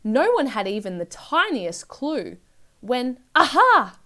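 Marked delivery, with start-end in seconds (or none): 3.3-4.0 loud, high burst of discovery; triumphant cry. A narrator's reading. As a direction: cry out as the idea strikes. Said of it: "cried the sister".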